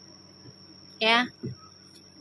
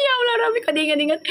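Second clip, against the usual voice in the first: mock alarm; 10 semitones higher, much faster than usual. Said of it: "Gue sampai ngomong gini".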